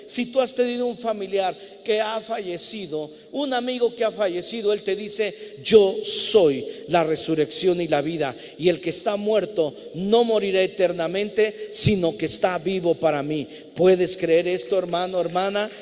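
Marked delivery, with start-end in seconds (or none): 5.6-15.7 loud preaching climax; preaching at full force. Acted. Pastor urges the congregation to believe, loud preaching. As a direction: proclaim it to the whole church.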